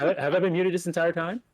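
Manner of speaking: just realised nobody could hear you; real surprise. Real, not acted. Realises his mic was muted.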